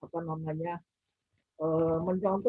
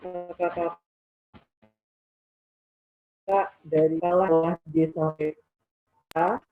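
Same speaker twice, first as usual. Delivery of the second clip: voice cutting out; 3 dB louder, slower than usual. Real, not acted. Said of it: "suaranya terputus".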